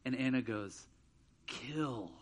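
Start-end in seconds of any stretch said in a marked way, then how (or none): 1.5-2.1 low, soft forbidden word; hushed confession. Retold or imitated. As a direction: imitate a kid quietly confiding something naughty. Child reluctantly confiding bad word.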